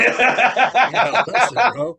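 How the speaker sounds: teasing. Real, not acted. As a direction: warn him jokingly. Teasing advice, laughing.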